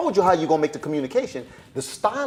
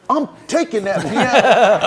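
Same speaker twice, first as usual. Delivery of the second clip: hammed-up, louder and slow; mock loud audition line; 6 semitones higher, 9 dB louder, slower than usual. Actor auditioning for the director, hammed-up loud. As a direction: bellow it slowly like a bad actor.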